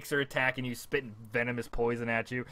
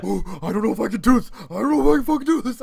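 high, loud mock panic; acting out frantic self-doubt, voice pitched way up; panicked player; 10 semitones higher, 9 dB louder, faster than usual. Player running up on an enemy, hammed-up panic.

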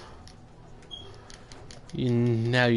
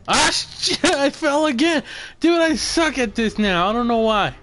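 frustrated outburst; 10 semitones higher, 3 dB louder than usual. Real, not acted. Falls again in the game.

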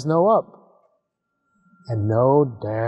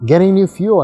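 bright eager answer, higher and louder; eager little rocket; 6 semitones higher, 8 dB louder than usual. Acted. Little rocket Gus tells Zor his plan, eager. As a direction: answer with pride and hope.

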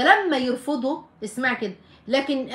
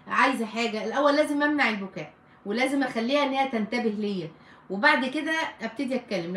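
usual speaking voice

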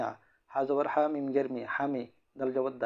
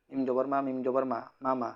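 usual speaking voice

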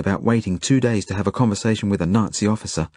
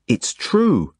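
put-on indignant boy; 6 semitones higher than usual. A narrator's reading. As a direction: insist like an offended kid. Jakob indignant.

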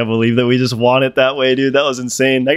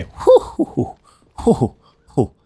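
relieved whew; 8 semitones higher, slower than usual. Real, not acted. Relief after close round.

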